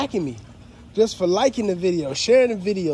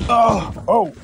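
startled; 3 semitones higher, 6 dB louder than usual. Real, not acted. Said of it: "I almost dropped the phone".

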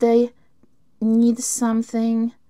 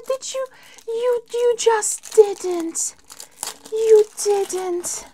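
high disbelief; 11 semitones higher, slower than usual. Real, not acted. Disbelief at the friend's gift.

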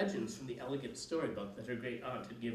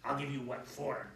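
plain reading voice

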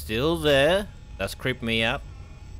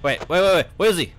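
panicked; 8 semitones higher, faster than usual. Real, not acted. Panicking, enemy vanished.

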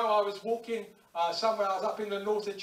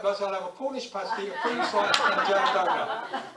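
laughing confession; 5 dB louder than usual. Real, not acted. Giving in to cakes.

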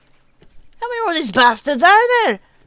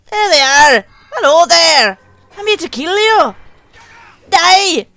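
loud, gleeful villain threats; menacing taunt; 2 semitones higher, 7 dB louder than usual. Acted. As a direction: relish the threat. Taunting enemies in battle.

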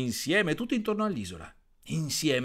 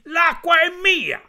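high, loud and slow, claiming the water; possessive shout; 11 dB louder, slower than usual. Acted. Frog Gianni loudly claims the water as his, slow high shout. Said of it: "gridò Gianni".

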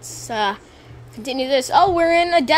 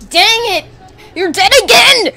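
louder, higher frustrated scolding; mock-annoyed shout at the screen; exasperated yelling; 6 semitones higher, 7 dB louder than usual. Real, not acted. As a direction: scold Max for dying again. Character dies again.

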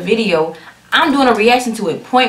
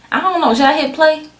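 playful teasing; 7 semitones higher than usual. Pretend. Teasing viewers before hitting play.

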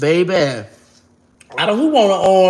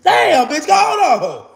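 much higher and louder, giggly squeal; squealing protest; 10 semitones higher, 4 dB louder, much slower than usual. Pretend. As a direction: squeal it like someone being tickled. Pretend being tickled.

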